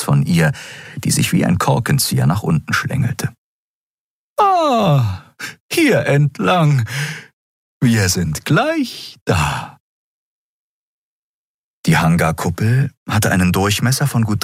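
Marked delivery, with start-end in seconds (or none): 4.4-9.8 high, airy voice for Xea, calm and explaining; Xea, hohe Stimme. Acted. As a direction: pitch it up high, thoughtful guide. Xea guides the others. (hohe, ruhige Frauenstimme einer Figur)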